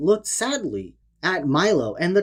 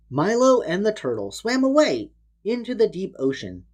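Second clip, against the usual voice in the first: plain reading voice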